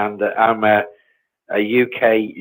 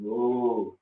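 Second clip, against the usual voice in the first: ordinary delivery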